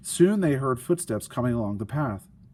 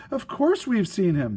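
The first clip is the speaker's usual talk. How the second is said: insisting; 8 semitones higher, 3 dB louder than usual. Acted. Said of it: "they insisted".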